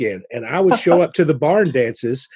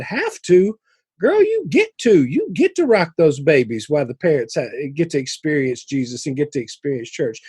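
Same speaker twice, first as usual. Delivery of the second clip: facetious mock scolding. Leader corrects a reluctant nursery volunteer, facetious mock scolding. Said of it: "I was being somewhat facetious".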